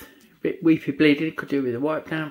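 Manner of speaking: normal talking voice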